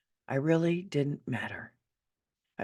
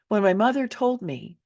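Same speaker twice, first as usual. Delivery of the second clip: ordinary delivery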